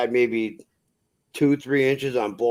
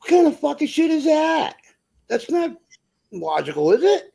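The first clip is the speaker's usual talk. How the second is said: much higher, mock-outraged pitch; arguing with the trucker, much higher outraged disbelief; 3 dB louder than usual. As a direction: squeal it in disbelief. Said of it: "I'm like".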